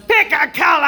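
loud, high mock barked order; brusque salon worker. Nail technician ordering the customer to choose, mock brusque. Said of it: "And she goes".

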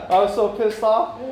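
high, loud, still laughing; words squeezed out through laughter. Real, not acted. Telling the room how angry he was, still laughing loudly.

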